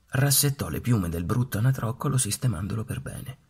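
ordinary delivery, nothing marked

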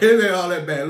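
said through a laugh; loud chuckling reassurance. Real, not acted. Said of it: "I started laughing".

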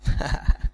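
short chuckle at one's own joke; little self-amused laugh. Real, not acted. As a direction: chuckle briefly, pleased with the joke.

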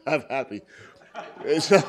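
said through real laughter; laughing. Real, not acted. Joking about being divorced twice, laughing. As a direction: let the laugh spill into it.

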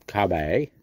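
Kirby in a mock British accent, said "Kah-bee" with no r. Shown as a demonstration. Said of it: "We could say his name with an accent".

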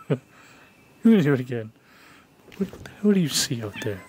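ordinary talk, nothing marked

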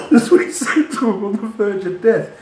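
high, loud words through real laughter; laughing retelling. Real, not acted. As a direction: crack up while repeating it.

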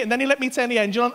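usual speaking voice

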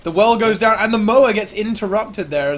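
high-energy esports caster; excited play-by-play. Real, not acted. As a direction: call the fight as it heats up. Live play-by-play of the fight.

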